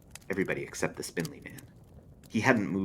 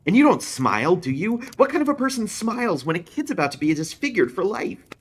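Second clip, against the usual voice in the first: much higher, louder, near shouting; anguished outburst; 9 dB louder than usual. Acted. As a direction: let the anguish burst out. Anguished outburst about the crash.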